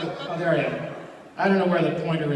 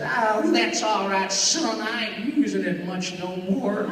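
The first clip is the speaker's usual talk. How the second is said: put-on slow, cracking country old-timer; Pat Buttram; 2 semitones higher, slower than usual. Old vaudeville star forgives the voice thief, cracking drawl. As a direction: stretch it out, countrified and breaking. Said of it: "He said".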